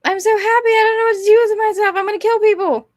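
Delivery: high, hammed-up cartoon villainess; put-on high voice, comically unhinged; mock manic heroine. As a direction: gush it high, madly overjoyed. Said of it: "and she's all like".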